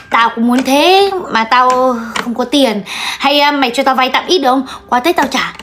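low, slow admission of being broke; hesitant confession. Acted. Shyly asks friend for a loan. (ngập ngừng hạ giọng)

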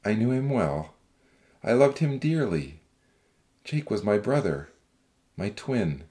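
deep, wistful man's voice; low, subdued sorrow; sad smile. Acted. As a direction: recall a lost brother, low and tender. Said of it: "Zach smiled sadly".

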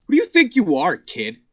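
loud, high indignant challenge; angry shout. Real, not acted. Exposed creep rounding on the YouTuber, loud indignant shout. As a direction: push back hard, real anger.

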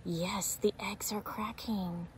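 hushed whisper. Acted. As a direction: whisper it to build suspense. Suspense while reading to kids.

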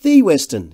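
"The" hit high and loud; emphatic THE. A narrator's reading. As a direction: stress "The" to mean the famous one. Devine stresses his friend's fame.

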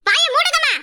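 angry. Acted. Mother defends accused daughter.

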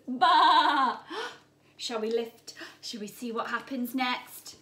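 plain reading voice

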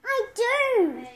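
high eager reply; keen little yes. A narrator's reading. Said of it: "cries Nabu".

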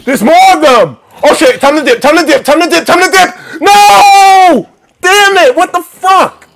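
high, loud desperate shouts; panicked screaming. Real, not acted. Player screams as the monsters swarm and kill him, panicked screaming. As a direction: flee in real panic, screaming.

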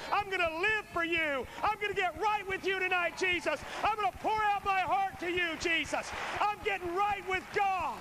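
rising, impassioned prayer to Jesus; fervent preaching prayer. Acted. Preacher praying aloud to Jesus, building fervour. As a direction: pour it out, building in pitch.

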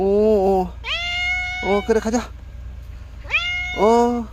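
high, doting pet talk. Real, not acted. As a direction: coo lovingly at the cat. Greeting cat Choco, baby talk.

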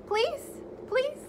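high, whiny pleading. Acted. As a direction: beg like a kid. Reader begging to stop reading.

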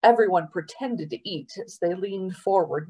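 plain reading voice

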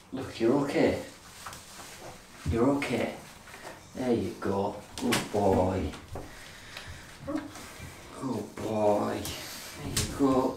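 soft, low soothing voice; calm the frightened dog gently. Real, not acted. Soothing scared dog in bath.

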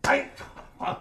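ordinary delivery

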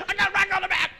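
very high, very loud comic scream; mock yell. Driver to honking cars, high frantic mock scream. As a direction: holler it back at the honkers.

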